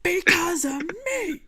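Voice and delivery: high, slow, tearful movie speech; dramatic cutscene voice. Acted. Player quoting the game's mentor, tearful and slow. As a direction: plead it like a dying mentor in a film.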